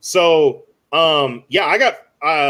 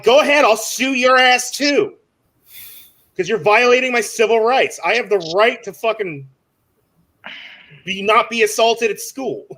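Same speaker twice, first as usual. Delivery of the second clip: retold defiant outburst; 4 semitones higher than usual. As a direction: play a livid teen daring them, voice raised. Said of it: "fucking screaming at this adult".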